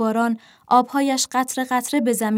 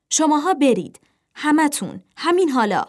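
sharp raised-pitch dismissal; angry order; 5 semitones higher, 3 dB louder than usual. A narrator's reading. Ernest orders the young crows away, angrily. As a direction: send them off in short, forceful bursts.

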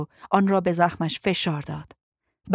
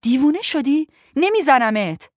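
raised, higher protest; indignant, louder outburst; shocked refusal; 6 semitones higher, 6 dB louder than usual. Acted. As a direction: refuse loudly in disbelief.

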